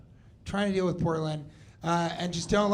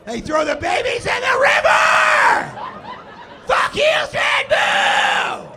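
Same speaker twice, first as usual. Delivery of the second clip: screamed high, loud and slow; furious shout; 8 dB louder, slower than usual. Acted. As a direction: scream it in mock fury. Opposite comedy rant climax.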